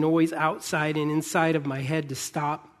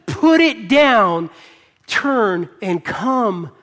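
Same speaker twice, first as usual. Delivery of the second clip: loud, higher-pitched preacher's voice with drawn-out words; impassioned preaching; 5 semitones higher, 8 dB louder than usual. Acted. Preacher urging the weary to put their burdens down, impassioned. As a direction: preach it forcefully, stretching the key words.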